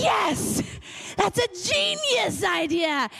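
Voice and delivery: high, gleeful yes, stretched out; delighted agreement. Retold or imitated. Jumping at a friend's terrible idea, delighted.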